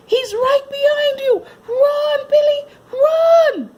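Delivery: high, loud, slow urgent shout; excited yell. Acted. Children yelling at Billy as the puppy closes in. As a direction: scream a warning through laughter. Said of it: "the children yelled".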